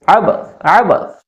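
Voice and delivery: आबस said slowly twice; slow repetition. Shown as a demonstration. Teacher slowly repeats आबस for students, slow. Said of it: "आबस भी बोलते हैं".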